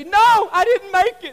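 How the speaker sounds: high, loud slow wail; mock crying. Pastor voicing a rejected contestant crying. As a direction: whine it like a sobbing loser. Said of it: "they say".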